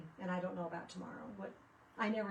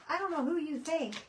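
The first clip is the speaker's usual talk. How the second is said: exasperated retort; 8 semitones higher, 6 dB louder than usual. Retold or imitated. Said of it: "I'm like".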